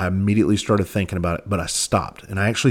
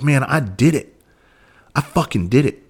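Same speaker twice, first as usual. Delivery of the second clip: happy, excited; 4 semitones higher than usual. Retold or imitated. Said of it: "I was happy".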